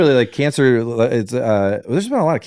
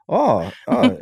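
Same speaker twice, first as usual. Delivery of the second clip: high, startled little gasps of confusion; mock surprise; 10 semitones higher than usual. Comedian playing a baffled president, high startled gasps. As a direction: play a baffled president, pitched well up.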